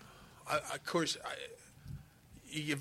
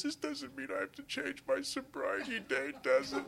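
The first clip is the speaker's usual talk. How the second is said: tearful, higher voice, mildly sobbing; crying; 11 semitones higher than usual. Retold or imitated. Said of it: "he starts crying".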